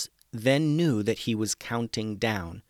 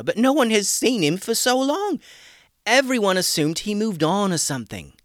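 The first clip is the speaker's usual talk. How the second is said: put-on excited young man; 8 semitones higher, 6 dB louder than usual. Chris voiced as character.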